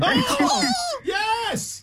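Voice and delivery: high-pitched celebratory whoop; shrieked, jubilant reaction; delighted cheer. Real, not acted. Hosts cheer the platypus sighting.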